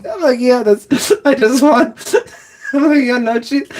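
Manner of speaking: high, squeaky laughing retelling; laughing through words. Real, not acted. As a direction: struggle to get the quote out through laughter. Laughs through retelling his order.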